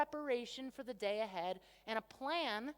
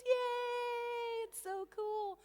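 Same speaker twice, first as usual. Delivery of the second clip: mock cheer; 9 semitones higher, much slower than usual. Mock cheer about daily systems.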